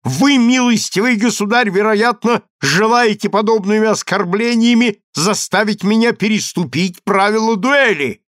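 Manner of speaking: high, sustained protest; offended, high-pitched declamation; indignant cry. A narrator's reading. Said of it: "закричал Александр".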